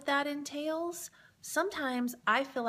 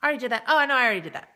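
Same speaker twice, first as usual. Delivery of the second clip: mock exasperated protest; 9 dB louder, much faster than usual. A viewer loudly insisting to Sherry she already did that, building from mutter to loud. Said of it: "You're saying".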